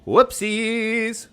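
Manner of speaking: high, loud sing-out; playful high exclamation. Real, not acted. Streamer reacting to a game mishap, high playful call. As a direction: sing it out high, holding the ending.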